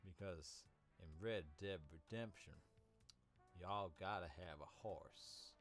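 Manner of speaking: a cheesy cowboy Southern accent; bad Southern accent. Shown as a demonstration. Showing the bad Southern accent from his video. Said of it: "it was a little bit like this".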